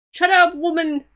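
loud, high furious yell; angry shout. Acted. Telling off the mother in the video, angry yell. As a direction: shout her down.

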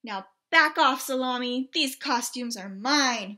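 loud, bossy pig ordering him off; bossy shout. Acted. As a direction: snap at him to back off. Pig bossing Trevor.

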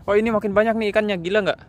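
fast, high, loud excitement; excited. Real, not acted. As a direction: blurt out thrilled. Excited at many fish.

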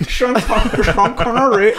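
put-on high laughing Indian accent; giggling through a bad Indian accent. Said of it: "a very bad Indian accent".

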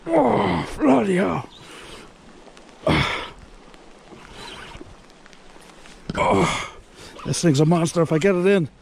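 excited. Real, not acted. Hooking monster eel.

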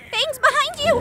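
high, loud battle warning; shouted warning across the fight; urgent warning. Acted. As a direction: yell the alert. Warning an ally in combat.

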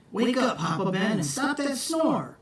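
high, quick wake-up call; rising call to wake him. Acted. Jack waking Papa Ben, high call.

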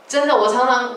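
ordinary talk

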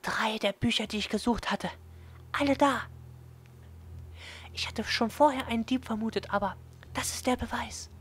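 put-on high, triumphant girl; half-whispered triumph; excited girl voice. Yuko excited, narrator voiced.

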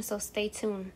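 usual speaking voice

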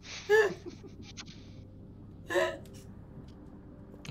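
high airless wheezing laugh. Real, not acted. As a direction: laugh until only wheezing comes out. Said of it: "like, wheezing".

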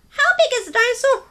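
high, loud curious little penguin voice; a tiny penguin full of questions. Acted. Story reader voicing small penguin Pipkin asking big questions. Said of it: "said Pipkin".

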